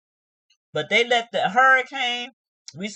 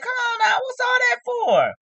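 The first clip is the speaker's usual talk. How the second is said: exasperated; 14 semitones higher than usual. Real, not acted. Vlogger reacting to her tantrum, high exasperated cry. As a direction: plead in disbelief.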